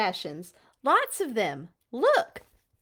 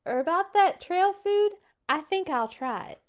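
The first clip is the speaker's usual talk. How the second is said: usual speaking voice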